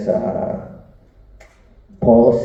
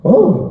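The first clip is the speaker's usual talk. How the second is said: put-on high, louder pleased gasp of an old lady; surprised little moan; 13 semitones higher, 5 dB louder than usual. Old lady lets slip a noise during the head massage, high and loud. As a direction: let out a sudden high pleasured oh. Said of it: "a wee noise I'd never heard before".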